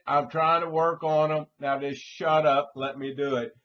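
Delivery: mock exasperated manicurist telling the customer to hush; exasperated retort. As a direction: tell her off, fed up. Worker's imagined retort, loud.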